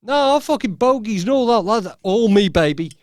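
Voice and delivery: loud, cocky bragging in mock voice; boastful Heather. Heather bragging to doubters that the scares were her acting, loud and cocky. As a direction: brag loudly that it was all her. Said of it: "She was just like".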